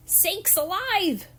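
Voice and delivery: slow, high, astonished cry; gasped exclamation. A narrator's reading. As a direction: gasp it out in amazement. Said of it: "he gasped".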